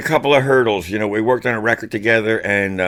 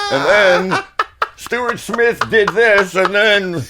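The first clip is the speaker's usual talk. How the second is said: mock impression; 12 semitones higher, much slower than usual. Imitates talker's arm-waving delivery.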